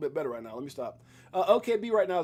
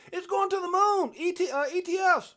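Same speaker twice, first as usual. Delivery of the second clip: much higher, excited call; shouting it to the moon; high, pumped hype voice; 12 semitones higher, 3 dB louder than usual. Acted. Hype call, far timbre.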